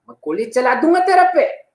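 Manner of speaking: mock threat. Threatening character quote.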